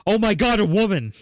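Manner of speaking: high, thrilled exclamation; mock starstruck gushing; enthusiastic. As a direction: imitate a caller bursting with excitement. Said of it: "so much enthusiasm in their voice".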